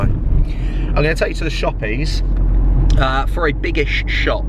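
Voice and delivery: slow, lilting mock Irish accent. Vlogger heading to the shops in a mock Irish accent. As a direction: keep the Irish accent going. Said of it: "Every time I try the Irish accent".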